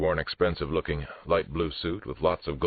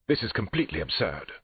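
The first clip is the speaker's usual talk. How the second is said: high-pitched affronted protest; indignant; 6 semitones higher than usual. Acted. Suspect protesting the detective's search, indignant. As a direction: dismiss it with indignation.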